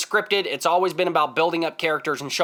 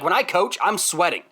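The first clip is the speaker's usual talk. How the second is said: normal talking voice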